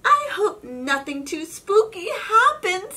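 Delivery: high, loud little cat voice; a hint of a nervous kid; high excited Pete voice. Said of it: "says Pete".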